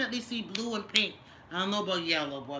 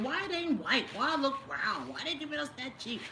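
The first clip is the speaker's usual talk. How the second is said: mildly raised, quick mock complaint; a hint of puzzled customers whining; quoting everybody; 7 semitones higher than usual. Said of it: "everybody wondering".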